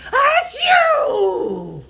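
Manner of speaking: loud, high hammed-up sneeze; huge comic achoo; big sneeze. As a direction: blast out a giant bear sneeze. Pepper makes bear sneeze.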